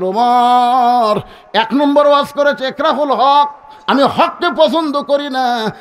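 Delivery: slow, high chanted reply; ringing sermon argument; preaching. Acted. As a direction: stretch the words out over the crowd. Preacher acts Ali's raised reply.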